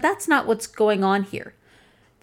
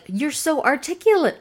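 higher, patronizing; mock compliment; 7 semitones higher than usual. Quoting backhanded compliments, mock patronizing. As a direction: gush falsely. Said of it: "Compliments like".

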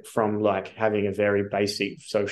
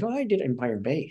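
lightly imitated Irish accent, raised pitch; a hint of an Irish friend's voice; Irish mentor; 4 semitones higher than usual. Mentor answering where he did it, light Irish accent. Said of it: "he's Irish".

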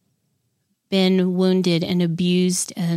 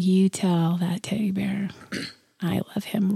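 whispering; 5 dB quieter than usual. Retold or imitated. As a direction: whisper it back gently. Whispered reply to child.

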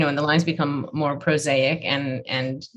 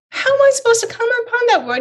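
exasperated protest; 6 dB louder, faster than usual. Retold or imitated. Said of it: "And I said".

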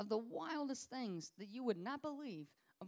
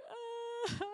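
sudden high cry of release. Retold or imitated. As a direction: let out a high breaking cry. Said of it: "they're like".

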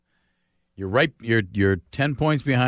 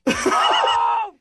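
pained yelp. Real, not acted. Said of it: "I have the worst leg cramp".

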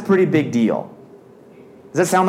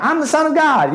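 sudden high, grandiose announcement; pompous boast in a hallway; mock grand claim; 12 semitones higher than usual. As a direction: play a kid proclaiming himself divine. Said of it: "somebody ... was like".

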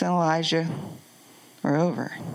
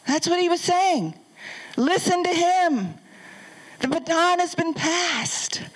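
high, emphatic preacher voice; preaching at full force; 10 semitones higher than usual. Acted. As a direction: drive the command home to the church. Preaching God's command.

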